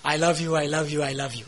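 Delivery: soft, hollow repeated I love you; mock empty murmur. Preacher mocking husbands' empty words, soft and hollow. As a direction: imitate a husband saying it by rote, quietly.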